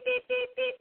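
high beeping horn noise; imitated car horn. Host mimics the dudes honking at her car, high beeps.

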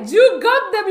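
loud, high reenacted cheer; thrilled retold yell; excited shout. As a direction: cry it out in elated disbelief. Retelling joy at getting tickets.

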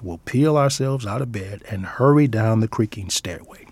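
ordinary delivery

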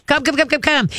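high, bright coaxing call to a dog; excited dog call. Shown as a demonstration. Trainer calling a dog on a long line, high excited coaxing. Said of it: "now start shuffling backwards".

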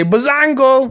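high triumphant Bazongo; victory shout. Shown as a demonstration. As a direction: call it out like winning. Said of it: "you say".

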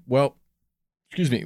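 burping mid-sentence. Real, not acted. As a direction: talk through a burp. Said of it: "I'm burping".

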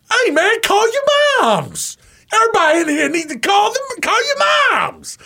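high, loud twangy coach shout. Retold or imitated. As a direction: yell like the old coach at breakfast. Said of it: "He had the same kind of twang".

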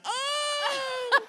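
surprised yelp. Retold or imitated. Sees photo of the three.

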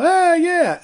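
high, loud startled ah yeah; awkward surprise. Retold or imitated. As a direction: caught off guard by a fan. Awkward reply to stranger.

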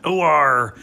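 hammed-up West Country call; West Country accent. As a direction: bark it like a West Country yokel. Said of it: "everyone's doing the West Country accent".

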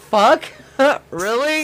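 high, loud startled outburst; shocked. Retold or imitated. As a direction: blurt it out, then laugh. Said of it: "I'm like".

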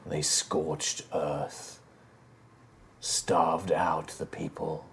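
put-on an old emperor's spirit recounting dark history; grave ancient spirit. GM voices Callus telling lore.

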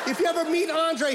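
ordinary talk, nothing marked

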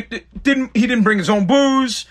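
ordinary talk

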